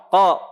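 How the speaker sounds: "Ko" from the back of the tongue, lips unrounded; Qaf without pouting. Shown as a demonstration. Teacher corrects students' qaf, unpouted "Ko". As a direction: say "Ko" open, without a pout. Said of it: "Tanpa monyong".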